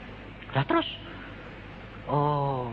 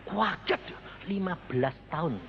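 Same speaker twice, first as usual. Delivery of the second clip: ordinary talk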